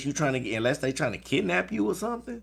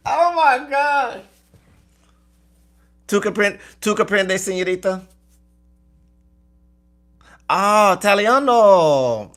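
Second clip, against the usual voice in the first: mock Spanish-accented character; 5 dB louder, slower than usual. Put-on old Spanish-speaking character.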